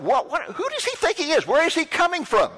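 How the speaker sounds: who does he think he is, flaring up; indignant inner outrage. Retold or imitated. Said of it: "I was really getting mad".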